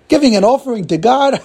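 high, loud incredulous echo; laughing mockery. Cleaner hearing Joseph's explanation, laughing mockery. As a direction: throw it back at him in ridicule. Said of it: "the assistant starts laughing".

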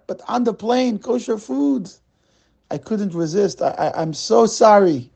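put-on apologetic Russian-accented stammer. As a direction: play the eager Russian immigrant in a light Russian accent. Russian man apologizing on plane.

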